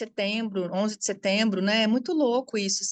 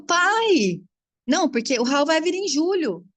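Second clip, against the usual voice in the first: 1 protesting; 6 semitones higher, 5 dB louder than usual. Retold or imitated. Retold argument with father.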